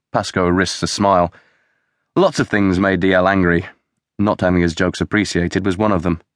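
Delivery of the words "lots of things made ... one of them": ordinary delivery, nothing marked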